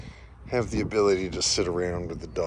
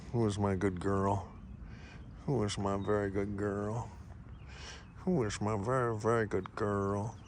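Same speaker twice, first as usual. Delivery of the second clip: soft, cooing praise for a good dog; pet praise; 7 dB quieter than usual. Real, not acted. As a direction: coo at the dog softly.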